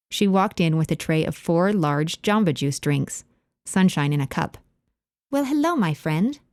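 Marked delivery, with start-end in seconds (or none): 5.3-6.4 lightly voiced English lady, high greeting; a friendly hello with a hint of an English accent. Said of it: "in her thick English accent".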